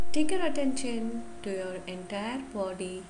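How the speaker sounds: slow, measured yoga nidra guidance; guided meditation. Acted. Yoga teacher guiding listeners to relax the whole body, guided meditation. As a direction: lead them gently through the body, phrase by phrase with long pauses.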